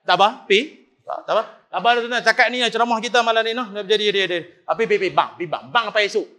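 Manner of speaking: ordinary delivery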